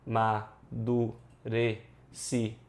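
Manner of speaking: slow, separated syllables in "Amadurecimento". Shown as a demonstration. Teacher giving a phonetic transcription, syllable by syllable. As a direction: sound out A, ma, du, re, ci, men, to. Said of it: "seria algo mais ou menos assim".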